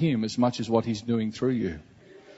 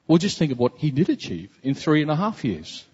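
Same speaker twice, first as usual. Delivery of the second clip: ordinary talk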